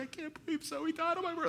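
high, fast, breathy-free little girl voice, imitating a child in dismay; imitating a distraught little girl; upset young daughter. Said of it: "Izzy's like".

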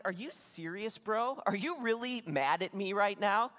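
incredulous God. Retold or imitated. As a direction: ask as if you can't believe it, imitating. God voiced as incredulous at Jonah.

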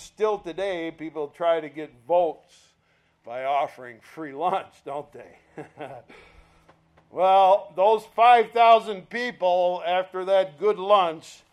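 normal talking voice

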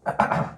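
quick dry throat clear. Real, not acted. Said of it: "just tickle in my throat".

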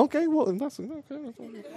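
high, soft voice fading to almost nothing; airy, hushed resignation; bluffing exit. Retold or imitated. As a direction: trail away as if leaving the shop. Child bluffs a walkout, fading away.

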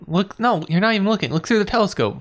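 exasperated high urging; urgent high pleading. Acted. Urging friend to look.

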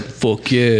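imitated Arkansas accent with a laugh. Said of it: "Arkansas accent".

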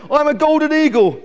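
high, loud proud indignation; outraged self-important cry; squawking. Acted. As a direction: squawk it as an insulted eagle. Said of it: "squawked Glen".

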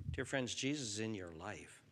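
ordinary delivery

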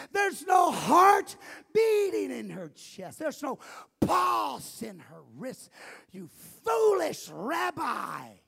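high, loud, slow scornful shouting; indignant scoffers jeering; mocking mourners. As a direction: shout down the foolish rabbi. Preacher voices mocking mourners.

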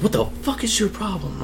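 high, hushed mock yell; retold yell. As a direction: imitate dad yelling, without the volume. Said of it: "And then he yelled".

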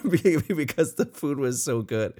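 laughing through the end of the sentence; real laugh breaking into the words. Real, not acted. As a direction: chuckle at his own tears. Laughing about weeping over food.

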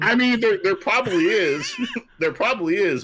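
high, loud laughing admission. Real, not acted. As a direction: burst out laughing as you concede. Laughing concession to friend.

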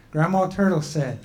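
usual speaking voice

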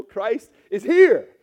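slow, loud, high proclamation; ringing declaration; proclaiming. Acted. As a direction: announce it with triumph. Proclaiming the return.

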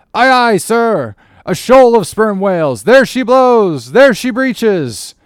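high, loud, drawn-out sighting calls; lookout's excited cries. Acted. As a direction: sing out each sighting slowly.

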